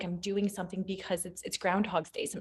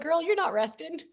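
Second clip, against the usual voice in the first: teasing scold; 7 semitones higher than usual. Retold or imitated. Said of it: "And I'm like".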